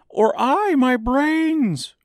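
very high, loud, slow wail. A narrator's reading. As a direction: wail it out tearfully. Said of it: "wailed the Scarecrow".

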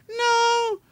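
a light high mock of the girl at the stall; imitating a woman. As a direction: squeal it like a puzzled young woman. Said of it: "She goes".

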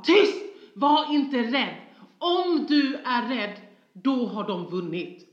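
high, very loud and slow bellowing; furious roar. Retold or imitated. A father roaring at his frightened daughter, furious bellow. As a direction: roar it as a command, full force. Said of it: "skriker det mest fruktansvärda avgrundsvrål".

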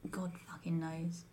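unvoiced muttered aside; whispered. Real, not acted. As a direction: whisper it under breath.

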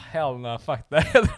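loud, drawn-out, laughing refusal; emphatic refusal. Retold or imitated. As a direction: belt it out in amused disgust. Acted disgusted refusal of blood sandwich.

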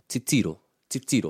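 "titiro" with the second syllable stressed; stress on middle syllable. Shown as a demonstration. Teacher showing a learner where stress falls, middle-stressed "titiro". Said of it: "The stress is on the middle one".

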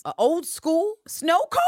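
high, loud, slow drawn-out question; incredulous suggestion. Retold or imitated. Host scolding them about dessert options, slow mock outrage. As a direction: stretch it out in disbelief. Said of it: "And I'm like".

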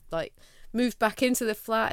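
ordinary talk, nothing marked

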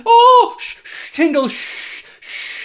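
whispered taunting shush; mock shushing. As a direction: hush the rival mockingly. Mock shushing rival fan.

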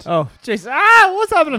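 shocked loud cry; startled shout. Real, not acted. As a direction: yelp in alarm at the noise. Sudden loud video plays.

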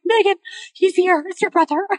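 high, breaking-news squeal retold; stunned excitement. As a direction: blurt it high, on the edge of laughter. Retelling stunned text to her sister.

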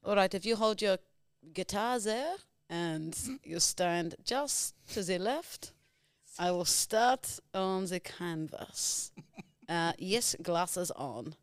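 put-on slow, deliberate Romanian-accented painter. Painter posing a customer and admiring the canvas, Romanian accent. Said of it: "You're Romanian".

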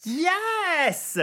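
high-pitched delighted yes; real burst of excitement; triumphant cheer. Real, not acted. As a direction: celebrate getting it right. Contestant celebrates a right answer.

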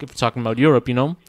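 ordinary talk, nothing marked

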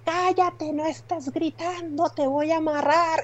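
high-pitched mock scolding, drawn out; yelling nurse. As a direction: imitate a nurse shrieking orders at patients. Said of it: "oyes a las enfermeras de".

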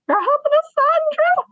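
retold very high-pitched tearful squeal; imitated high, emotional squeal. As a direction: squeal it like the sonographer bursting into happy tears. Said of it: "And she's bawling, crying".

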